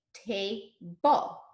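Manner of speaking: TABLE slowly split into ta... ble; segmented syllables. Shown as a demonstration. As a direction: pause between the two syllables of TABLE. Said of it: "to use the segmentation part".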